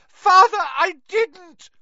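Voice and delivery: high desperate shriek. A narrator's reading. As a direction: scream in terror at father. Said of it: "shrieked the boy".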